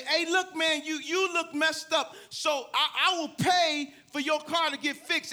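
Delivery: loud, high eager offer; excited offer. Retold or imitated. Preacher to crashed driver, loud high eager offer. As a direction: call it out loud and high like an overjoyed friend. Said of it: "And I said".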